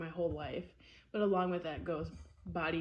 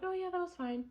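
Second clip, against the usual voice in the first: high, airy nonchalance; breezy high voice; 11 semitones higher than usual. Retold or imitated. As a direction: shrug it off brightly. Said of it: "I'm like".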